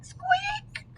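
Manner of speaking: tiny high mouse squeak. Acted. The mystery noise in Owl's house, high squeak. Echoing child's answer.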